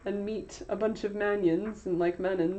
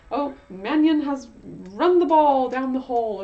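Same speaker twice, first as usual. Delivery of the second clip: a hint of a sportscaster, higher, louder, slow; mock sports commentator; 4 semitones higher, 7 dB louder than usual. TV announcer calling the play, lightly imitated commentator. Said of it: "they kept saying".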